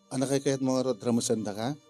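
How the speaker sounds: ordinary talk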